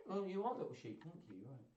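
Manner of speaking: ordinary delivery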